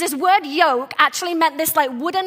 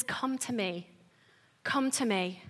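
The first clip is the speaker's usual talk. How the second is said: very soft, low invitation; hushed and gentle, cutting through the harshness; tender; 5 semitones lower, 12 dB quieter than usual. Acted. Jesus cutting through the harsh inner voice, tender. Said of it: "There's such a tenderness".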